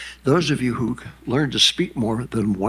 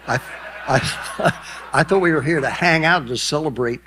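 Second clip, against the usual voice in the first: laughing through the words, louder, higher; 6 semitones higher, 4 dB louder than usual. Real, not acted. Laughing at his own reunion joke. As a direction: laugh while saying it.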